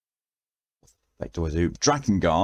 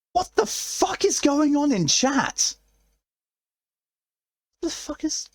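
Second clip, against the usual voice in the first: shocked high shriek. Real, not acted. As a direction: shriek at the chaos, stunned. Chat suddenly goes wild.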